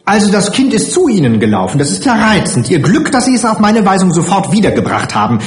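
high, quick indignant matron; empörte Heimleiterin. A narrator's reading. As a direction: rattle it off indignantly, pitch up. Indignant matron voiced by narrator. (entrüstet, schnell und mit hoher Stimme)

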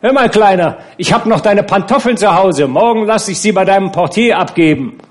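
high, loud and commanding; imperious call. A narrator's reading. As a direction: call across the room like a queen. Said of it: "mit herrischer Geste rief sie". (herrisch und laut über den Raum gerufen)

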